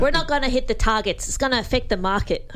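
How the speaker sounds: light mocking Australian politician voice; a hint of a broad Australian politician; Australian politician accent. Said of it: "Christ, listen to that".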